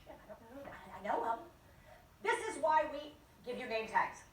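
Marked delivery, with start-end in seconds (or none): 0.1-1.4 flustered mumble. Retold or imitated. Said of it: "that moment of".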